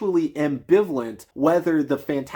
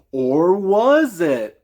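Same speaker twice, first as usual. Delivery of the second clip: louder and higher, very slow, milk the fake mystery; mock ominous tease; 5 semitones higher, 6 dB louder, much slower than usual. Parodying the film's twist, a slow hammed-up tease. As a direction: ask it like a cheesy cliffhanger.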